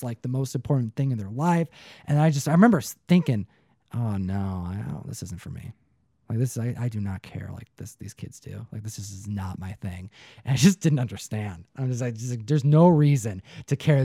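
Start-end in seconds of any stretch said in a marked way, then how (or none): 4.0-10.1 low, hushed, muttering to himself; retold thought, dropped low and quiet; hushed private realization; quiet inner thought.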